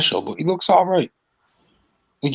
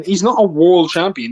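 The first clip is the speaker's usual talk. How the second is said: ordinary talk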